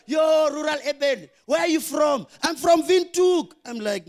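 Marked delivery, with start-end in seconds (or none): 0.1-2.3 loud, slow call across a crowd; mock call-out. Friends calling out to a rural newcomer, loud and slow. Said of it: "They were like". 2.4-3.5 louder, higher and slow; proud newcomer. Retold or imitated. Rural newcomer boasting to friends, loud, high and slow.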